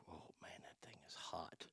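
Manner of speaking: soft awed whisper. Real, not acted. Whispering in awe at the gun on screen. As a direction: breathe it out under your breath in awe.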